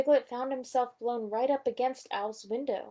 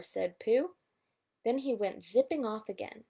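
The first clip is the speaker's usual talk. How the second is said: plain reading voice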